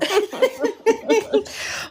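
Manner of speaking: high giggling laugh; giggling fit. Real, not acted. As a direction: laugh along, high and bright. Laughs along with the joke.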